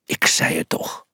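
low unvoiced whisper. A narrator's reading. Frustrated player whispers to himself on the pitch. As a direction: breathe it as a sour aside. Muttering after the goal.

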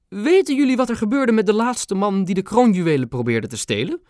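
high, regal question with menace; queen recovering her authority; stern queen. A narrator's reading. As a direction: regain composure and loom. Queen voiced by narrator.

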